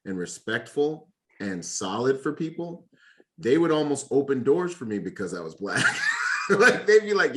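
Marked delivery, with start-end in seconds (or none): 3.4-7.4 laughing out the punchline louder. Real, not acted. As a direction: burst of laughter mid-sentence. Laugh heard on lines.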